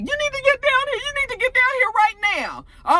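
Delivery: frantic. Retold or imitated. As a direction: call for help in a frantic rush. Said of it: "she's all frantic".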